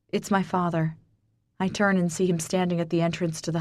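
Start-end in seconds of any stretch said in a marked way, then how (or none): none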